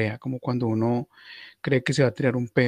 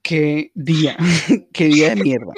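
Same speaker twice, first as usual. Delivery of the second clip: louder, fed-up cry; loud, laughing exasperation; exasperated outburst; 3 semitones higher, 8 dB louder than usual. Real, not acted. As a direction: vent your frustration at the whole day. Fed up with screaming child.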